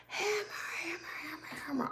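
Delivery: high, softened re-enacted holler; calling the dog. Owner searching for lost dog, soft high mock calling.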